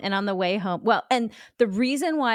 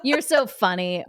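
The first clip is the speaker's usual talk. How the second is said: giggling, louder, playing the charmed star; flirty laughing reply; 2 semitones higher, 4 dB louder, slower than usual. Imagined Olympian charmed by reporter Ham, giggling. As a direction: laugh along at the reporter's joke. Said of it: "I don't know why his name was Ham".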